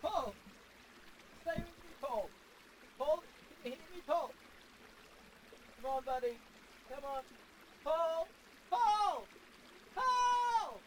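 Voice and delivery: high, panicked cries of his name; frantic calling. Acted. Calling to dying caller on phone.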